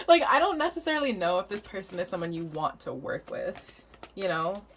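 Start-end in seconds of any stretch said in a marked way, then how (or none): none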